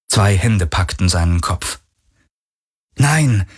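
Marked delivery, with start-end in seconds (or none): 3.0-3.5 verzweifelter Schrei. A narrator's reading. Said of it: "wollte Varidur schreien".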